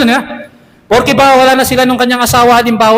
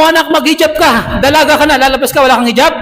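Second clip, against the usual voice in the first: higher, nagging mother's order; scolding mother; 5 semitones higher than usual. Retold or imitated. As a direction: nag the daughter to wear hijab. (nanay na nagagalit sa anak)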